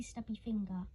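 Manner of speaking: ordinary delivery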